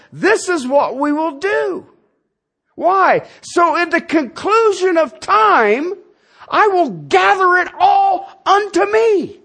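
high, slow, solemn pronouncement; voice of God. Acted. The Godhead declares its plan, in a high, slow, solemn voice. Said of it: "The Godhead came together and says".

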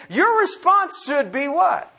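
usual speaking voice

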